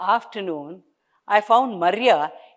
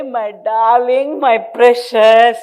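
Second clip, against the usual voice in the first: tender gushing; 4 semitones higher, 9 dB louder, slower than usual. Acted. Mother fussing over pregnant daughter.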